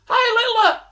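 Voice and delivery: very high, loud holler; shouted greeting. Retold or imitated. Man showing police what he shouted, high holler. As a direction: re-enact an innocent shout across the street. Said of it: "I just shouted across there".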